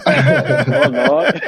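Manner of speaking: a high laughing oh Lord. Real, not acted. Guest reacts to the submissive women remark, laughing. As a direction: laugh it out in disbelief.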